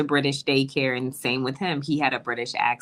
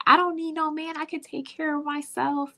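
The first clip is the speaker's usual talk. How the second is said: much higher, put-on mocking voice of a self-reliant woman; mocking independent woman; 14 semitones higher than usual. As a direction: parody the women who say they need no man. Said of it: "Yeah, like".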